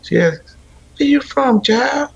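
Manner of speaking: lightly voiced Southern grandmother; imitating grandmother. Grandson retelling grandmother meeting his wife, light imitation. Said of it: "she said".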